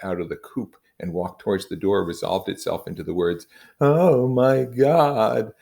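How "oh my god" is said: very slow, high, loud anguished moan; a husband moaning over his dead wife; gasping moan. Acted. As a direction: drag each word out in grief. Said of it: "uttered over and over again in a gasping moan".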